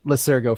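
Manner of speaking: Lycurgo with an S sound, "luh-SUR-go"; soft C pronunciation. Real, not acted. Host apologises for the name Lycurgo, soft S sound. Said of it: "Excuse me if I'm mispronouncing it".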